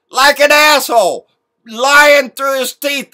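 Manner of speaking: loud and high, slow; angry outburst. Real, not acted. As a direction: spit it out in disgust. Angry interjection mid-quote.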